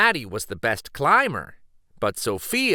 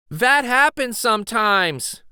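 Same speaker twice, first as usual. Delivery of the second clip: calling up; 10 semitones higher, 5 dB louder than usual. Acted. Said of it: "Maddie called".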